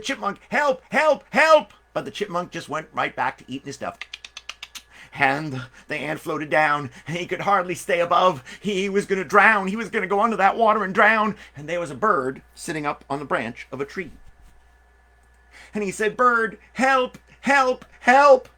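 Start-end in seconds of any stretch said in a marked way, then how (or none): 0.0-1.7 high, loud desperate calls; cry for help. Acted. Drowning ant begs the chipmunk for help, high and loud. 16.2-18.5 higher and louder pleading calls; cry for help. Acted. Drowning ant calls up to the bird, high and loud.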